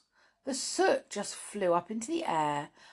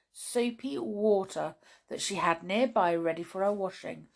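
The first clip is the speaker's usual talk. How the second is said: ordinary delivery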